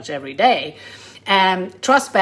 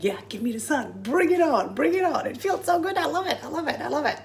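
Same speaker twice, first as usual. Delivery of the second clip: sun-loving cheer; 8 semitones higher, 5 dB quieter, faster than usual. Retold or imitated. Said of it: "and I'm like".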